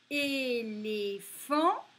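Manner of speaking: teacher sounding out Éléphant; slow, sounded-out word. Shown as a demonstration. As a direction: stretch the final phant of Éléphant. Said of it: "on l'entend à la fin".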